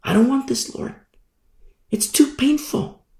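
soft, high-pitched plea to God; acted anguished begging; pleading prayer. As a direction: plead softly, high in the voice, as if in pain. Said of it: "have you ever prayed to the Lord, like".

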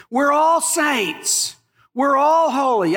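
emphatic proclamation. Real, not acted. Preacher's emphatic climax.

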